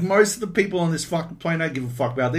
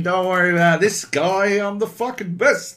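mock smug boast; 8 semitones higher, 6 dB louder than usual. Mocking his own smug bragging.

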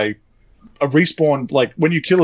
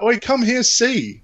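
high mock shout of the line; 1 mock yell; 8 semitones higher, slower than usual. Mocking how the guy yelled, high mock call. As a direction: parody the guy calling out. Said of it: "who yells that out".

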